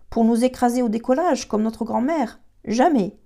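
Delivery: a firm no, voice lifted and loud; emphatic refusal. A narrator's reading.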